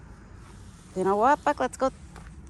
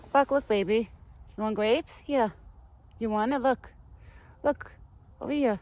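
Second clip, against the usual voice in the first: high, louder sing-song coaxing; coaxing the pet; 6 semitones higher, 4 dB louder than usual. Real, not acted. Owner coaxing Buck toward grapes, high baby talk. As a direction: coax him over to the food.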